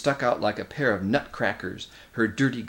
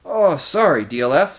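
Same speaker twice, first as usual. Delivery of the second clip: flustered apology; 6 semitones higher, 8 dB louder than usual. Acted. Edmund apologizes mid-scramble.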